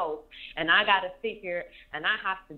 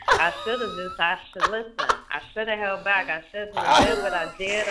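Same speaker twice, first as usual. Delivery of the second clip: normal talking voice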